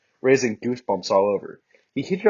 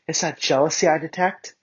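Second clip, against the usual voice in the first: teasing; 8 semitones higher than usual. Acted. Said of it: "I laughed".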